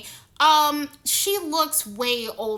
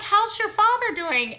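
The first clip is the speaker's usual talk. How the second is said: higher chirpy imitation of her; mock friendly question; 9 semitones higher, 3 dB louder than usual. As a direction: mimic her friendly asking. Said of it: "she's like".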